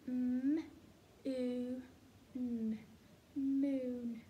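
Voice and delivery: "M, oo, n" then blended "Moon"; sounding out moon. Shown as a demonstration. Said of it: "Let's sound it out together".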